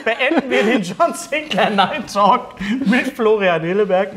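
signing off through real laughter; laughing. Real, not acted. Host closing the show to guests, laughing through it. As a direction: try to wrap up but keep cracking up.

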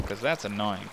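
usual speaking voice

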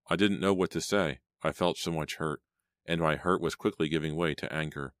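usual speaking voice

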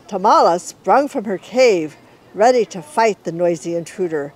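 ordinary delivery, nothing marked

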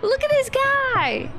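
squealing delight. Real, not acted. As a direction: squeal it the second time.

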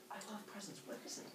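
very soft, high little voice; tiny intrigued character voice; curious Kronos. Acted. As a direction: ask in a hushed, childlike eagerness. Kronos curious about present.

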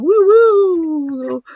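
high-pitched whooping cheer; high drawn-out woo. Real, not acted. Gamer hyping up the next match, playful high whoop. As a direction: let out a long, high whoo-hoo.

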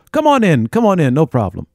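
high, loud, eager welcome that settles down; sudden welcome. Retold or imitated. Doorman suddenly welcoming the guest in, high and eager. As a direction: throw the door open with a burst of cheer.

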